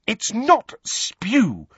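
high, slow, indignant correction; indignant high-pitched protest; heated. A narrator's reading. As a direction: correct them hotly, stretching the words. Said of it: "said Hermione heatedly".

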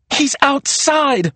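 high, drawn-out urgent cry; shouted. A narrator's reading. Maria answering Serena while seeking Dad, shouted. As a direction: stretch the warning out frantically. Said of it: "Maria shouted".

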